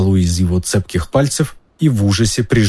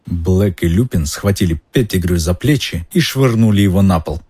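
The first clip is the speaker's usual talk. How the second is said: plain reading voice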